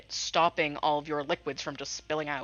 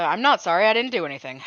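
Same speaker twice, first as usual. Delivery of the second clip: loud denial; 9 dB louder, faster than usual. Real, not acted. Player denies blame loudly.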